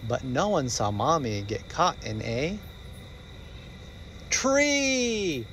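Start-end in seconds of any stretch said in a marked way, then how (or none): none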